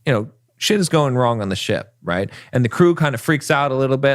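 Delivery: usual speaking voice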